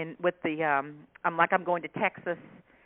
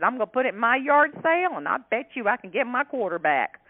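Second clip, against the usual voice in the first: higher, louder Southern country voice; country accent; 5 semitones higher, 4 dB louder than usual. Retold or imitated. Shopper telling the author she will resell it, higher country voice. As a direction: boast it like a thrifty yard-sale queen. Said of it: "She said".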